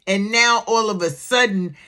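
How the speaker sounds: ordinary delivery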